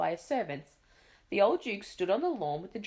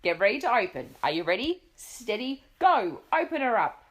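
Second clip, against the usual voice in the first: louder build to a shouted go; countdown call; 4 semitones higher, 6 dB louder than usual. A narrator's reading. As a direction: Duke timing the beak opening. Duke counts down for pelican.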